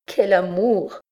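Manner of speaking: high-pitched, appalled exclamation; shuddering exclamation. A narrator's reading. Victim shuddering at the priest's love, appalled. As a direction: recoil from it, voice jumping up. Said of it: "dit la malheureuse en frémissant".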